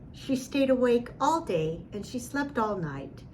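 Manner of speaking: ordinary delivery, nothing marked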